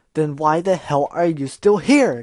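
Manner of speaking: very loud, slow, forceful demand; furious yell. Acted. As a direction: hammer each word. Gilda furious.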